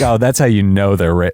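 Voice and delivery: ordinary delivery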